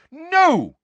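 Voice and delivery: very high, loud shout. A narrator's reading. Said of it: "shouted Missus Weasley".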